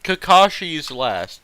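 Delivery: ordinary talk, nothing marked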